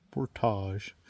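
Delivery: Portage with a soft zh ending, por-TAHZH; French-style pronunciation. Shown as a demonstration. Camper showing partner the local word, French-style Portage ending in "tahzh". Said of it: "how do Americans say it?".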